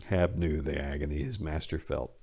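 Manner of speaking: ordinary delivery, nothing marked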